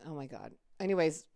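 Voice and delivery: ordinary delivery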